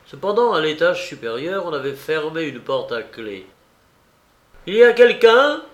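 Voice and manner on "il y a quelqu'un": loud, high questioning shout; calling out. A narrator's reading. Lestrade to whoever is behind the locked door, calling out. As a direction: call out sharply, pitch jumping up. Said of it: "s'écria Lestrade".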